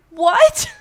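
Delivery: high, loud stunned rise; a shocked yelp. Retold or imitated. Answering a death threat, loud stunned what. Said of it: "I'm like".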